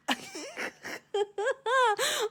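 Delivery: high, helpless giggle; embarrassed laugh. Real, not acted. Laughing at butchering a shell name, high giggle. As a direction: burst of high apologetic laughter. Laughs at own failed pronunciation.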